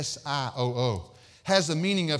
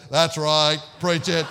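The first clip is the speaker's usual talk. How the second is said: loud mocking monotone; monotone amen-man; 7 dB louder, slower than usual. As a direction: mimic the droning heckler, loud and level. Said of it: "It was all monotone".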